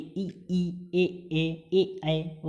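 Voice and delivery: "ई, ई, ए, ए, ए, ऐ" said slowly and carefully, tongue forward; front vowel drill. Shown as a demonstration. Teacher saying front vowels aloud for students to copy. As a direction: articulate each front vowel clearly for practice. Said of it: "आप बोल के भी दे सकते हैं".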